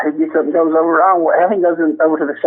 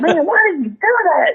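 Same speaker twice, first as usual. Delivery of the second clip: higher, mock outraged scolding; imitating Blackie; 10 semitones higher than usual. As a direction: pile the blame on the rookie. Retold fake disbelief.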